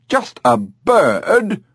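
high, slow, indignant parrot squawk; offended bird. Acted. Parrot insulted by Dolly, high slow indignant. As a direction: draw it out, insulted.

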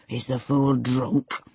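slow, lightly voiced stern master; measured, scornful master; gruff master's question. A narrator's reading. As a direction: ask it slowly, with contempt. Master questions a ranting servant.

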